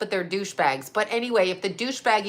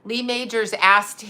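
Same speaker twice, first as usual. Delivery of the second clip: normal talking voice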